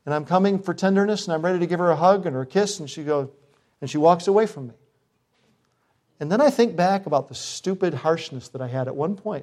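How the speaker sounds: usual speaking voice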